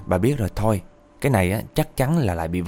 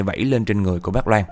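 plain reading voice

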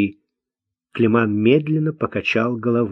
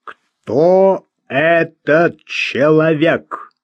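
very slow, sharp questioning; slow but firm; 4 semitones higher, 3 dB louder, much slower than usual. A narrator's reading. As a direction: demand it firmly, word by word. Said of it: "спросил он медленно, но твёрдо". (медленно, но твёрдо)

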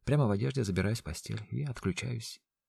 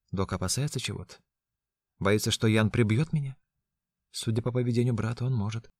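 ordinary delivery

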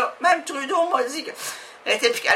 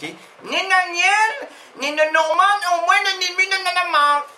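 higher mock voice mangling the name; silly put-on voice; 7 semitones higher than usual. Comedian in a higher silly voice introducing himself. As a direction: play a confused guest mangling his own name.

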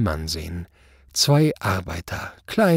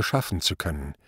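usual speaking voice

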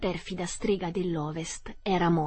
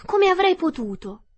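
agitated protest; 11 semitones higher, 8 dB louder than usual. Acted. Dorothy answers the witch.

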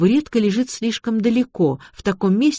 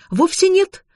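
much higher-pitched contradiction; arguing back; 13 semitones higher, 4 dB louder, slower than usual. A narrator's reading. Investigator contradicts the victim's mother, arguing back. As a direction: jump in high to dispute it. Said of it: "заспорила Аня".

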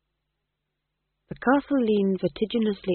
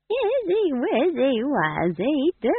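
very high, very slow cooing; baby talk; 10 semitones higher, 3 dB louder, much slower than usual. A narrator's reading. Nanny Og cooing at the baby, high slow baby talk. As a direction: coo at the baby.

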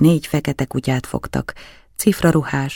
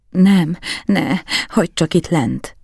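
breathy, higher-pitched plea; panting; 4 semitones higher than usual. A narrator's reading. Count refusing to be helped off the floor, panting. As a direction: gasp the words out, pleading. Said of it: "zihálta".

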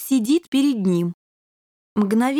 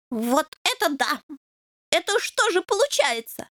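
much higher, wondering puppy; amazed; 6 semitones higher than usual. A narrator's reading. Puppy puzzles over the pacifist devil, amazed. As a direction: work it out aloud, astonished.

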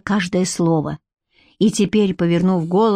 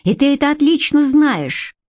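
higher-pitched angry retort; contained fury, pitch raised; infuriated retort; 7 semitones higher than usual. Acted. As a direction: throw it back at him, incensed. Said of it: "разъярённо процедила она".

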